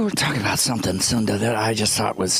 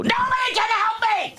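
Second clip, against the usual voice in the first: high, wailing cry; mock despair. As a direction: wail it like a hopeless cry. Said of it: "I go".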